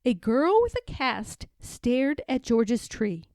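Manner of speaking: plain reading voice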